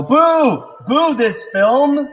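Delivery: high, loud drawn-out boo; jeering at the screen, loud and slow; mock booing shout. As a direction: boo the film from your seat. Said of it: "Just shout "boo" in the theater really loud".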